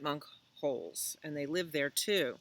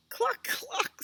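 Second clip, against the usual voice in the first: very high hen-like double cluck; clucking cow. Acted. Cow, having lost her moo, speaks to Pig, high clucking. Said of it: "said cow".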